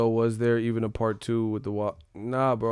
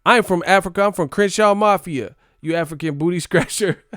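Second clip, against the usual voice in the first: higher, louder schoolyard comeback; kid arguing; 7 semitones higher, 6 dB louder than usual. Retold or imitated. Kid yelling back during the class argument, higher and louder. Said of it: "sound like a real life argument kids would have".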